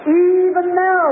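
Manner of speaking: high, drawn-out preacher's cry; acted outcry of faith; fervent plea.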